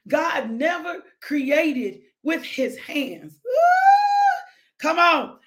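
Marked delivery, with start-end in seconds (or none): 3.4-4.8 high whooping cry of praise; ecstatic woo. Real, not acted. Preacher whooping to the congregation, ecstatic high woo. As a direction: let out a joyful woo.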